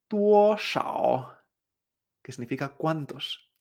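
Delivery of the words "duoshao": DUOSHAO, DUO alto y plano, SHAO grave; sostén DUO arriba y baja SHAO al fondo; tono uno tres. Shown as a demonstration. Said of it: "El uno tres". («duoshao»: alto sostenido y luego bajo)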